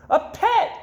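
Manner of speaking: high, loud joyful cry; excited squeal. Acted. Goldfish Gilbert cries out on finding a pet. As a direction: burst out with delight. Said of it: "Gilbert glubbed".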